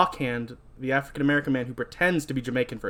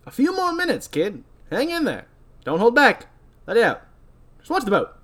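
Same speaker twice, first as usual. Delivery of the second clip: high, loud put-on captain voice; ferry captain character; 9 semitones higher, 4 dB louder than usual. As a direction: call it out as a hammed-up captain. Captain encouraging seasick kid.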